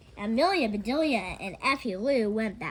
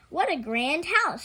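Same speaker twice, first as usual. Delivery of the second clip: put-on higher, louder, slow child's wonder; Effie Lou amazed; 6 semitones higher, 6 dB louder, slower than usual. A narrator's reading. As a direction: marvel at the big house. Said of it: "said Effie Lou".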